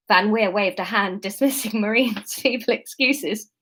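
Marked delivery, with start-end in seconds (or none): none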